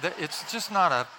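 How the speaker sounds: ordinary delivery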